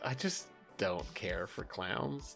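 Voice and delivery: ordinary talk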